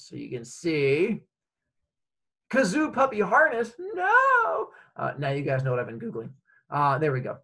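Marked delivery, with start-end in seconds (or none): 2.5-4.7 high squeal of embarrassment; embarrassed shriek. Real, not acted. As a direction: caught off guard, squeal it out. Embarrassing search shown on screen.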